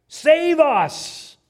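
loud, high desperate cry; crying out to God. Acted. Fishermen to God in the storm, crying out. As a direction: shout it as a plea. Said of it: "probably crying out to Jehovah God".

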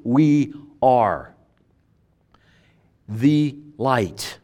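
"the light" slow, weight on "the"; "We are" then "the light", drawn out; stress on "the". Shown as a demonstration. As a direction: lean on "the", a pause before "the light". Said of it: "It's a definite article".

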